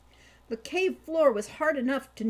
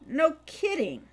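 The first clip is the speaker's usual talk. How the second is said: dryly; 6 semitones higher, 6 dB louder than usual. A narrator's reading. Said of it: "he said dryly".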